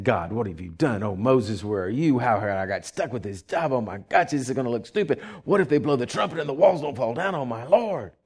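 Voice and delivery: low, hushed fretting prayer; quiet night-time dread, acted; private worry. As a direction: fret to God under your breath. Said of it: "sweating every night, saying".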